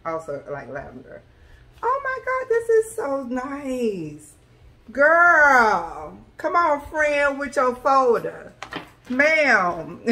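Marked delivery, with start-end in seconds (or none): 1.8-4.3 high, slow gushing delight; gushing high-pitched delight; thrilled squeal. Real, not acted. Thrilled over the package. 4.9-10.0 louder, higher, slow cheering on; loud, high delighted praise; excited praise. Real, not acted. Praising the seller's folder.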